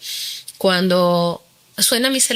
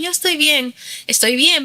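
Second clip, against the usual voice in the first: higher-pitched, retold reassurance; putting on being fine; 8 semitones higher than usual. Woman reassuring her calling siblings, lightly feigned cheer. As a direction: act out insisting all is well.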